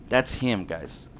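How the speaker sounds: ordinary delivery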